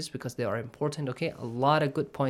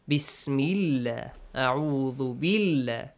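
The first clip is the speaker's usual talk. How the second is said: ordinary talk, nothing marked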